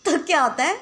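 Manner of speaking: talking through a giggle; laughing while telling the story. Real, not acted. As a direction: voice breaking into laughter. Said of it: "मुझे हंसी आ रही है".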